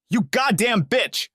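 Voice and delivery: a betrayed husband yells at his wife; furious yell. A narrator's reading. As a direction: spit each word out in rage. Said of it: "he yelled".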